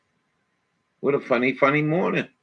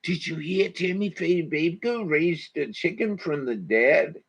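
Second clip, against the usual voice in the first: gossiping churchgoer; 6 semitones higher, 3 dB quieter than usual. Retold or imitated. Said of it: "all them people back then going".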